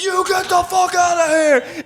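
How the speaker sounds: high, loud, slow furious shout; angry yell. Retold or imitated. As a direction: yell to chase him off. Chasing stranger off driveway, yelling.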